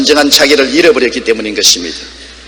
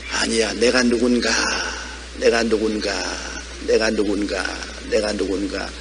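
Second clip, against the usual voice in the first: grandmother's low, very soft murmuring; imitated muttering; 5 semitones lower, 11 dB quieter than usual. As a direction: murmur it low, like repeating a prayer to yourself. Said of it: "무엇을 중얼중얼하고 계셔요". (할머니를 흉내 낸 낮은 중얼거림)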